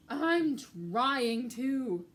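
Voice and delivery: very slow, loud weary complaint; exasperated. Acted. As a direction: drag the words out. Tired Mouse, exasperated.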